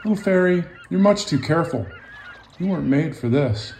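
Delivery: a hint of a breezy sailboat. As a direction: gently dismiss her. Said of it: "like Sailboat".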